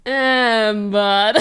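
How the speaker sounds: loud, drawn-out "Embar-" breaking off into laughter; slowed-down imitation. Learner mocking the slowed translator, loud drawn-out imitation. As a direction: mimic the slowed playback on "Embar-". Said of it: "it's slowed down like that".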